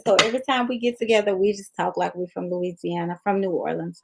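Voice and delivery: ordinary delivery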